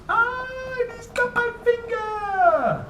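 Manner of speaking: very high, slow pretend squeal; hammed-up storybook fright; mock alarm. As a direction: act caught by the choctopus for the kids. Choctopus grabs the finger in story.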